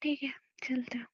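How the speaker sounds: hushed, near-whispered giving in; sullen. Acted. Woman gives in to going after argument, sullen whisper. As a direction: agree under your breath, hurt. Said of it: "गुस्सा मत हो".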